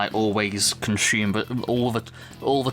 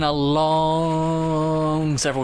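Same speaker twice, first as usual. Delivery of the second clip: very slow, higher, sustained vowel; drawn-out weariness; 5 semitones higher, much slower than usual. Real, not acted. Co-host admitting to being tired, drawn-out. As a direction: stretch LONG right out.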